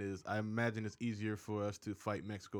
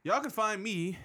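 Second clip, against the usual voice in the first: usual speaking voice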